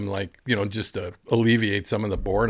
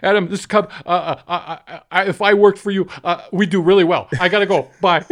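higher, louder nervous babble with laughs; flustered phone call; 7 semitones higher, 6 dB louder than usual. Retold or imitated. Caddy retelling his botched voicemail, flustered and laughing. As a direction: rush through it, tripping over yourself. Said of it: "it was just terrible".

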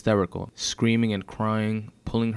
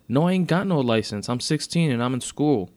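put-on louder, higher indignant teen; defensive protest; 6 semitones higher, 5 dB louder than usual. A narrator's reading. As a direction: argue back at the officer. Accused teen argues with officer.

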